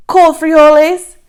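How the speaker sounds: high, loud mocking jeer. Kid taunting the others, high and loud. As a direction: fling it out like a playground taunt. Children trading insults.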